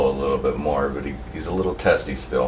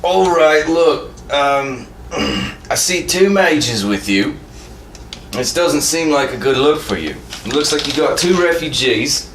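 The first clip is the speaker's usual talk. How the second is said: higher-pitched, confrontational Templar; hard-ass Templar; 9 semitones higher, 3 dB louder than usual. Acted. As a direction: press them like a suspicious lawman. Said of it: "the hard-ass Templar is gonna look back".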